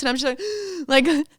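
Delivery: ordinary talk, nothing marked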